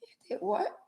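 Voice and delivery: breathless whisper of shock; whispered disbelief. Acted. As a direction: whisper it, stunned.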